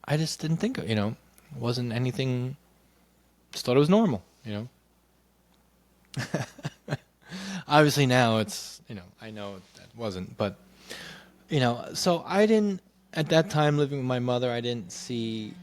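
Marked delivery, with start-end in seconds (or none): none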